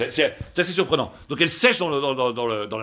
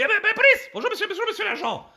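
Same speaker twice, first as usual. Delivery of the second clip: high-pitched mock-female falsetto; agitated woman. Woman hailing a police officer, agitated falsetto. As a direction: squeal it in comic agitation.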